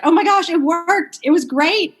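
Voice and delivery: high, loud burst of delight; thrilled. Retold or imitated. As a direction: gush like it finally worked. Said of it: "she was like".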